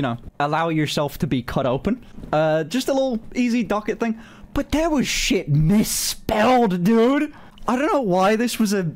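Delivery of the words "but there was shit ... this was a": exasperated rant. Real, not acted. Ranting about misspelled form.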